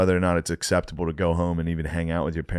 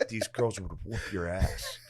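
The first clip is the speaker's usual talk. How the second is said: slowed, softer mock dad voice, laughing; dad's taunt; 9 dB quieter, slower than usual. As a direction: lay it on like a smug father. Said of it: "And he's like".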